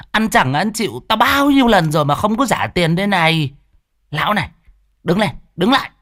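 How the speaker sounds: high, sharp scolding; angry woman. Acted. Angry food-stall woman berates drunk.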